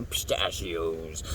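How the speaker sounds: low, soft, drawn-out offer; mock creepy coaxing, dropped low and slow; creepy lure. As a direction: murmur it slowly, low and soft. Acting out the creepy candy lure.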